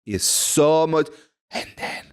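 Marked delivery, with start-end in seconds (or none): 1.5-2.0 breathy whispered demo. Shown as a demonstration. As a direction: drop to a hushed whisper. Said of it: "when you whisper".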